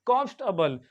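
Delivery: कॉम्फर्टेबल as "KOMF-tuh-bul"; कॉम्फर्टेबल with no R and a weak "tuh"; correct pronunciation. Shown as a demonstration. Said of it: "क्या पढ़ते?".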